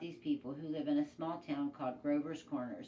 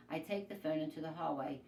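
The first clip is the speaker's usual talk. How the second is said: plain reading voice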